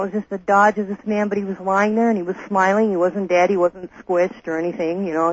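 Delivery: ordinary talk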